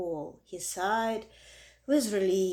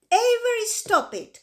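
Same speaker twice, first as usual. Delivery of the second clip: much higher, louder scolding cry; cried out; 12 semitones higher, 8 dB louder than usual. A narrator's reading. Mother scolding Avery for clowning, loud sharp cry. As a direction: cry out to stop the boy. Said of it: "cried his mother".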